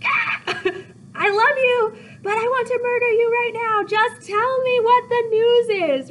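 very high, strained, drawn-out screech; on the verge of throttling someone, played for laughs; exasperated Juliet. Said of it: "Juliet's like".

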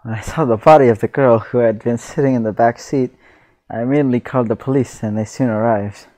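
ordinary talk, nothing marked

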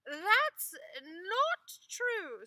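very slow, high, loud protest; insist, offended; wife protesting Ivan's insult; indignant wife. Acted.

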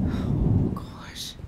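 whispered under breath; breathy whisper. Real, not acted. Nervous player whispering to herself. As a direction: barely voice it in fear. Scared aside.

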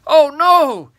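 high, loud cry of dismay; horrified shout. A narrator's reading. Giant hearing a dreadful secret, horrified shout. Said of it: "he shouted".

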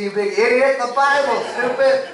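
very high, loud, hammed-up exasperation; mock frustrated outburst. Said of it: "he's getting frustrated at me".

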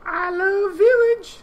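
squeaky villager voice. Acted. Reading a villager's line in a high slow voice. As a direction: say it like a tiny sweet villager. Voicing a game character.

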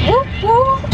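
high whoop whoop; high excited whoop whoop; mock cheer. Real, not acted. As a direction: hype up the car ride. Cheering on way to festival.

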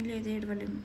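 low, soft, teary voice holding back tears; tearful denial. Real, not acted. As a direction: deny crying while close to tears. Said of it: "ఇప్పుడు ఎందుకు ఏడుస్తున్నావు మళ్ళీ?". (కన్నీళ్లతో నెమ్మదిగా)

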